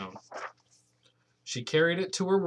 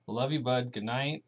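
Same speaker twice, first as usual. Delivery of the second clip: plain reading voice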